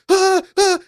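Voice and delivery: high yelping shriek of fright; jolted two-beat yelp; startled scream. Retold or imitated. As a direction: shriek like something just lunged at you. Monster crawls at her suddenly.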